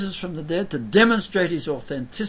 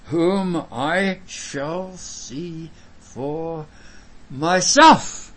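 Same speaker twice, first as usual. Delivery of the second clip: very slow, word by word, swelling on "myself"; drawn-out solemn proclamation; emphatic declamation; 3 dB louder, much slower than usual. Acted. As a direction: draw out each word and land hard on "myself". Stresses Job's individual hope.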